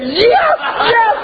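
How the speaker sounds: high, laughing mock cheer; silent cheer. Father cheering inside as his son tells off the coach, high laughing mock cheer. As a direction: cheer like a dad secretly thrilled. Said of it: "in my heart of hearts, I was saying".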